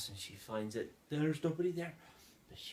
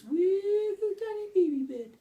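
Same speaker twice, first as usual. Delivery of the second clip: very high, squeaky little voice; high-pitched baby-small squeak; teeny-tiny voice; 4 dB louder than usual. A narrator's reading. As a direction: shrink the voice up high for something tiny. Voicing the tiny baby bed.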